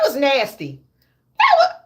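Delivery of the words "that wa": very high squeaked start; squeal of disgust. Real, not acted. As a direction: shriek it out in disgust. Repeats reaction in disgust.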